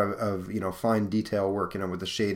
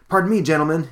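higher, louder polite quip; cheeky speedster; 7 semitones higher, 7 dB louder, faster than usual. Acted. Teen speedster excuses himself while spinning through a convict, cheeky. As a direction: toss it off like a cocky teen hero.

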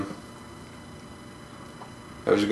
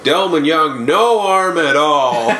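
raised, drawn-out announcer call; mock sports announcer; 5 semitones higher, 3 dB louder, slower than usual. Retelling the announcers' verdict on a terrible throw, mock announcer call. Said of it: "the announcers were like".

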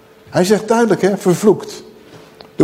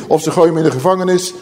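normal talking voice

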